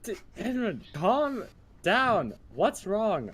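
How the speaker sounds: calm him in a small voice; lightly squeaky character. Acted. Character soothing, higher voice.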